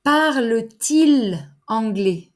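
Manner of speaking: Parle-t-il spoken slowly, T clearly joining il; teacher models the inverted question to learners, slow clear liaison; slow liaison demo. Shown as a demonstration. Parle-t-il with a distinct linking T, slow. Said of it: "Avec la liaison".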